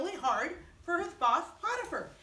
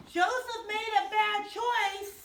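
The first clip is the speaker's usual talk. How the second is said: lying accusation; 7 semitones higher, 4 dB louder, slower than usual. Acted. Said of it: "she lied".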